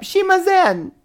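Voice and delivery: put-on high, loud Japanese accent. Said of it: "your little Japanese accent".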